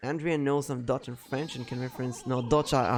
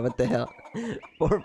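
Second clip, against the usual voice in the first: ordinary talk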